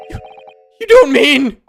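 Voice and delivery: alarmed gasp. Acted. Natsume fears being accused.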